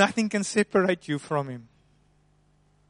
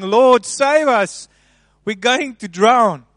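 a loud, higher cry for rescue, slowing at the end; mock panicked plea; 5 semitones higher, 10 dB louder, slower than usual. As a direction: call out to the Lord as if drowning. Disciples crying out in storm.

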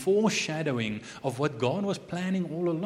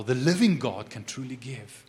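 ordinary delivery

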